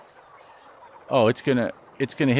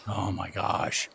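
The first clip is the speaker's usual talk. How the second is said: soft, slow exasperated sigh; dismayed under breath; 5 semitones lower, 10 dB quieter, slower than usual. Real, not acted. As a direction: groan it quietly to yourself.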